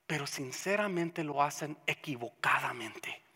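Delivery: hushed unvoiced whisper. Pastor confiding a hard truth to the congregation, whispering. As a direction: drop to a whisper to let it sink in. Drops voice after loud build.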